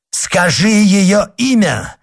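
loud, high, drawn-out command; shouted demand. A narrator's reading. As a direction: cry out the demand, pitch leaping up. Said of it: "крикнул монах".

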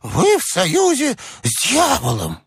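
high, hysterical accusation; very high-pitched old man's protest; panicky old man. A narrator's reading. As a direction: wail in suspicion and fear.

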